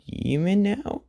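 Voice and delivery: a high, rising disbelieving question; incredulous. Retold or imitated. Inko asks her son if he's a demon, incredulous. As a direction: ask it as if it can't be true.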